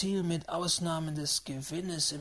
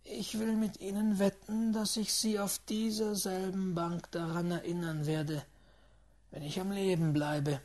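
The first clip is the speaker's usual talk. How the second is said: ordinary delivery, nothing marked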